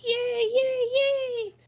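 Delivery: very high, loud squeaky cheer; happy creature cheer. Acted. Dancing leaf cheers on Holb's hand, very high and loud squeak. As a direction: cheer like a thrilled tiny leaf.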